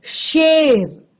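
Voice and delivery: शेर very slowly, with a drawn-out "sh"; palatal sh. Shown as a demonstration. Teacher demonstrating the palatal श, long slow "sh" in शेर. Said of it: "जो आपका तालव्य श है".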